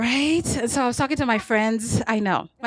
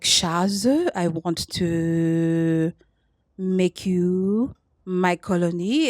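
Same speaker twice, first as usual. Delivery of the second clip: put-on low, very slow, lightly French-accented purr; French seducer; 5 semitones lower, much slower than usual. Comic voicing the French match's colonial text, low slow purr. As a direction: draw it out low like a smug Frenchman. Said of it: "He's like".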